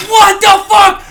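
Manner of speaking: loud high disbelief; shocked shout. Retold or imitated. Marine shouting at news of miscarriage. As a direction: bark it out stunned. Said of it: "I'm like".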